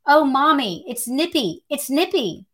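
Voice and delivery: a hint of a screaming son; mild mock scream. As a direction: read the panicked call, pitch up high. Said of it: "all I could hear was screaming".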